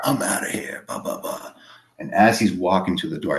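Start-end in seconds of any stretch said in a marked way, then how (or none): 0.0-1.5 a hushed, breathy aside; muttered under the breath; whispered quote. Retold or imitated. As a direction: whisper the stepfather's exit line. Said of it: "he's like".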